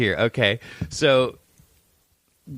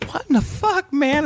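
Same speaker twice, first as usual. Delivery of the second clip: very high squeaky indignation; outraged disbelief. Retold or imitated. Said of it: "he's like".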